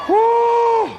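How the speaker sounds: high joyful whoop to the crowd. Real, not acted. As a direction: let out a loud country whoop.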